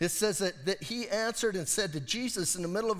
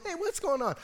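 higher, friendly, easy question; Jesus asking casually; 9 semitones higher than usual. Retold or imitated. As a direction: lightly voice Jesus checking in on them. Jesus voiced asking the disciples.

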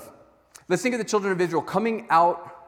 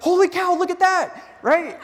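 much higher and louder astonished cry; amazed gasp; 14 semitones higher, 7 dB louder than usual. Acted. Aaron mimicking the crowd seeing the calf, amazed high cry. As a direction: gasp at it like a magic trick.

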